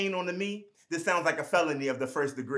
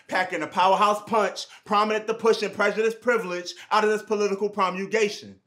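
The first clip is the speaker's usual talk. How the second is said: fast forceful delivery; 3 semitones higher, 5 dB louder than usual. Acted. Rapid alliterative poetry climax.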